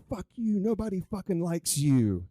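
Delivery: loud, high, retold teenage insult; foul-mouthed yell. A senior cursing out his teacher in class, loud and high. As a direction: hurl it at the teacher like a rude senior. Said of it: "he starts just foul mouth".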